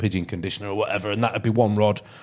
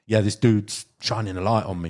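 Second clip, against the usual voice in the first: usual speaking voice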